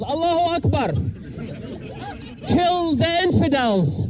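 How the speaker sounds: ordinary talk, nothing marked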